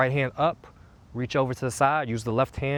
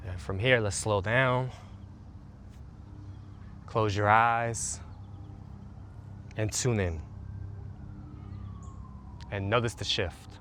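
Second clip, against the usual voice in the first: guided meditation; 2 semitones lower, 3 dB quieter than usual. Acted. Coach leading listeners through a calming routine, soothing meditation voice. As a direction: guide them gently into the body.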